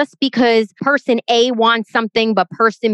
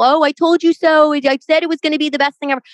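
mock told you so; 6 semitones higher, faster than usual. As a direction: gloat like a know-it-all friend. Mocking an imagined I-told-you-so.

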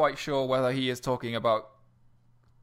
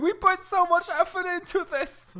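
high, loud mock-distraught wail; hammed-up outraged lament; wailing IBM guy; 7 dB louder than usual. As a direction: wail it like a wounded IBM employee. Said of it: "just some guy at I-, I B M going".

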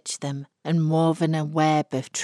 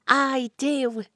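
eager vow; 9 semitones higher than usual. A narrator's reading. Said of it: "could be heard from the back row".

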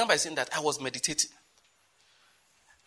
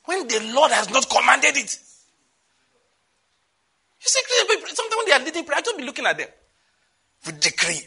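high, loud, impassioned preaching; fiery preaching; 13 semitones higher, 9 dB louder than usual. Acted. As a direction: preach it hard over the crowd. Preaching at full force, high and loud.

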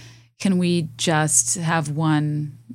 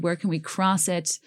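normal talking voice

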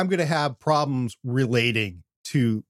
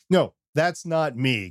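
normal talking voice

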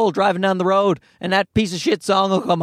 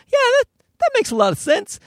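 high, quick eager agreement; mock-dim, squeaky enthusiasm; naive young listener; faster than usual. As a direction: play a gullible convert buying in. Acts young gullible listener.

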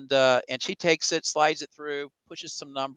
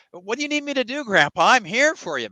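higher, quicker, keen offer; eager to help; 8 semitones higher, 5 dB louder than usual. Acted. Grateful grandson offering grandpa his help, eager. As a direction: jump in brightly with a higher, louder pledge.

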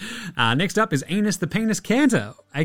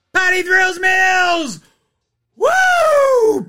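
very high, loud slow shout; hyped shout; 8 dB louder, much slower than usual. Real, not acted. Host hyping Patty Mills as legend, shouting. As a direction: scream his name like a fan. Hyped award winner call.

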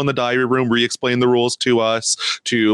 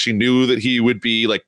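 normal talking voice

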